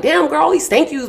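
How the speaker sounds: imitating listeners complaining about all the thanks; mock audience reaction. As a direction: poke fun at yourself as the crowd. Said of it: "y'all probably like".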